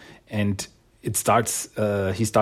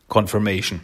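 normal talking voice

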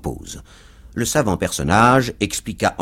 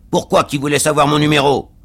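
loud, higher-pitched accusing question; aggrieved cab driver; 6 semitones higher, 5 dB louder than usual. A narrator's reading. Cab driver accuses his passenger, loud and aggrieved. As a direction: demand it loudly, pitched up. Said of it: "répondit le cocher de fiacre".

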